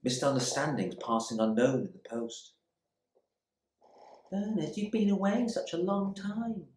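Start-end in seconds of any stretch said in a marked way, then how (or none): 4.3-6.7 higher, lighter woman's voice; Queenie's voice. Acted. Bernard recalling his wife's gentle reproach, lighter woman's voice. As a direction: voice her fondly as the wife.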